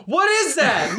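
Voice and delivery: high-pitched mock scream; imitated scream. Teasing bandmate for screaming across the room, mock high scream. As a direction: mimic his sudden high holler. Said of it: "you just screamed".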